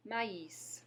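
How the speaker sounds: Maïs, ma then is, final s said; Maïs in two separate vowels; French maïs. Shown as a demonstration. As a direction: model Maïs for repeating. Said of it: "Go ahead".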